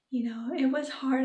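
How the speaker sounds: ordinary delivery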